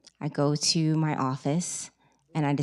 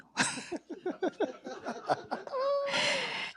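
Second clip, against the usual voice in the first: giddy laugh; 8 dB quieter than usual. Real, not acted. Laughs recalling meeting Stephen King.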